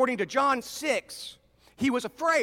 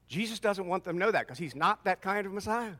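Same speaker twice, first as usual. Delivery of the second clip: ordinary delivery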